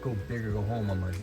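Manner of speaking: normal talking voice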